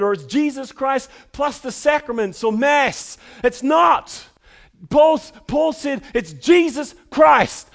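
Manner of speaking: loud, high, emphatic pulpit delivery; impassioned preacher at full volume; full-force preaching. Acted. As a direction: drive each point home to the congregation.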